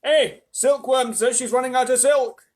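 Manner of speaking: high, loud yell. A narrator's reading. Centipede reporting a silk shortage, urgent yell. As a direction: shout a warning up from below. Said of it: "yelled the centipede from below".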